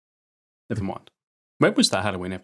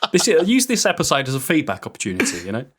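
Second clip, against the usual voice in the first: laughing; 5 semitones higher than usual. Real, not acted. Laughing while asking for feedback.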